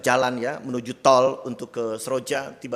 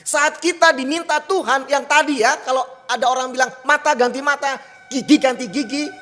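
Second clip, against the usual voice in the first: higher, louder impassioned preaching; full-force preaching; 11 semitones higher, 7 dB louder than usual. Acted. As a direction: drive the point home to the congregation. (khotbah berapi-api, lebih tinggi dan keras)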